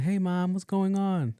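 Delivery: high-pitched phone greeting; imitated daughter. Friend picking up her mom's call, imitated daughter.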